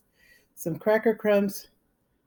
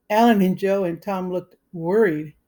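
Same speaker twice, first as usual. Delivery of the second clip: ordinary delivery, nothing marked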